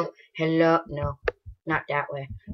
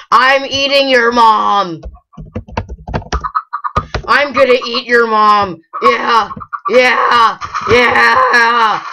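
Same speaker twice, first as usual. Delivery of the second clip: loud, high mock-evil gloating ending in whoops; gloating yell; 6 semitones higher, 7 dB louder, much slower than usual. Player gloating after killing the chicken, shouting. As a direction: cackle over your victory.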